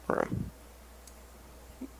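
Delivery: usual speaking voice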